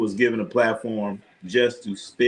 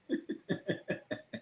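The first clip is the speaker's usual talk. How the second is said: breathy silent laugh; 13 dB quieter than usual. Real, not acted. Laughing at spray-on hair joke.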